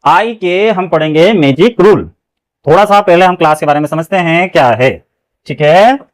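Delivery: booming teacher intro; loud class opening. Acted. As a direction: project to the whole class. Teacher opens class loudly.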